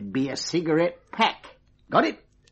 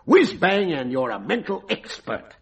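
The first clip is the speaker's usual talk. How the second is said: ordinary delivery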